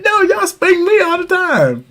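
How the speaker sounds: put-on high, whiny kid's protest; childish protest. Dad as a kid protesting to his parents. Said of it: "He'd be like".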